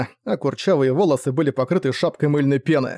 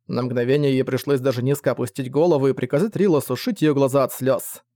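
plain reading voice

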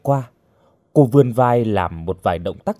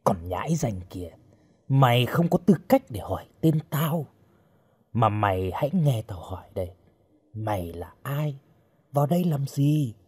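menacing captor; 6 dB quieter than usual. Acted. Strange man interrogates the bound girl, quiet menace. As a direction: question the captive with quiet contempt.

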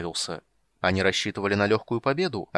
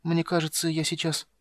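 weak kender voice; 6 semitones higher than usual. Acted. Wounded kender near fainting.

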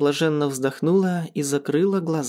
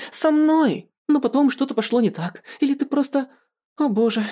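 high-pitched, indignant accusation; hurt reproach; 8 semitones higher than usual. A narrator's reading. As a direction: plead and accuse, pitch climbing. Jilted woman confronts her boyfriend.

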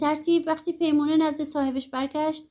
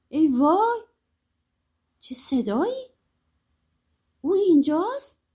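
put-on scared exclamations; mock alarm, storybook style; feigned fright; 3 semitones higher than usual. A narrator's reading. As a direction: play at being startled, with questioning rises. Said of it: "با لحنی که مثلاً انگار خیلی ترسیده گفت".

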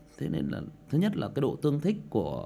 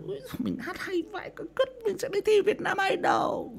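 much higher bragging voice; boastful bathroom singer. Retold or imitated. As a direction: brag about your singing. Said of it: "Mình chui trong nhà vệ sinh hát".

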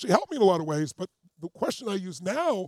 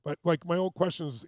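normal talking voice

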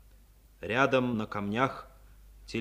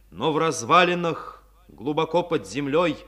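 narration lifted higher and louder with solemn force; rising dramatic narration; 4 semitones higher, 6 dB louder than usual. A narrator's reading. Narrator heralding the defenders still holding out, raised solemn voice. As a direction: announce the defenders' last stand with swelling pride.

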